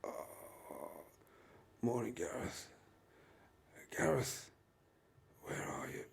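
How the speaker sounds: soft, breathy whispered moaning; hungover groan. Acted. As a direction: wake up with a pounding head. Waking up with a hangover.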